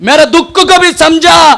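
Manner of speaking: high, loud, stretched preacher's cry; full-force preaching. Acted. Preacher rousing the church about sin, full force. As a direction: thunder it at the congregation. Preaching God's covenant with Phinehas.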